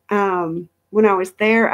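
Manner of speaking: normal talking voice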